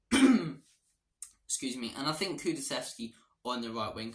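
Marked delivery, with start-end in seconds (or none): none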